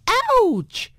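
sharp high yelp of pain; cry of pain. A narrator's reading. Wife stubbing her toe in the dark, high yelp. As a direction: yelp as the toe hits something. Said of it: "she cried".

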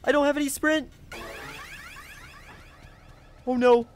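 high loud panic; frantic as the chase closes in; panicked. Real, not acted. Chased, can't hide.

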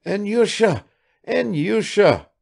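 a mother calling her son; old mother's quavering cry; quavering woman. Acted. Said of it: "a woman's quavering voice".

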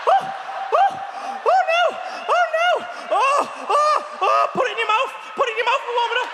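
high, loud, panicky squealing; panicked squeal. Retold or imitated. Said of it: "I was like".

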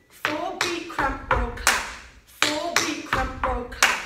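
slow, spelled-out step names; slow teaching call of the footwork. Shown as a demonstration. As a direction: lay each step name down slowly for the class. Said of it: "teacher calls the steps slowly".

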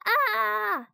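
very high, held storyteller's scream; frightened yell. A narrator's reading. Annie sees the floating bone, high frightened yell. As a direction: sustain a high-pitched "Ah" in fright. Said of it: "yelled Annie".

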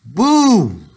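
a high, loud spooky Boo; ghostly shout. Acted. Moose in a sheet scaring everyone, shouting loud and high. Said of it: "and shout".

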